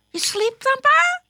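put-on loud, very high child voice; waking him up. A narrator's reading. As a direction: pipe up loudly to rouse a sleeper. Sister finally waking Thumper up.

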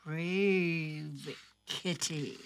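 slow drawn-out reading; storybook reading. Acted. Host reading the picture book page, slow. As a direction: read it slow for the kids.

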